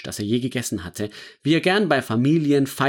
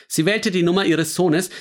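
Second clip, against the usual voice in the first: ordinary delivery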